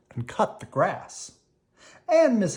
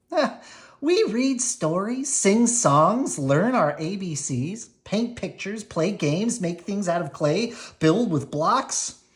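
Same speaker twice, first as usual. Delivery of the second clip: teacher voice; 7 semitones higher, 4 dB louder than usual. Acted. Teacher lists activities, voiced.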